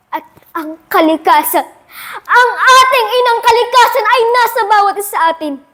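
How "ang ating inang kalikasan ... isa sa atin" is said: high, soaring oratorical climax; ringing, raised-pitch proclamation; impassioned appeal. Acted. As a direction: lift the whole line in fervent declamation.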